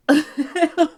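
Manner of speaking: bursting high giggle; a nervous laugh before the rant. Real, not acted. Laughing at herself.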